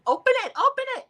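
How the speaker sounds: hammed-up shriek of open it; mock yell. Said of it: "[name] is yelling".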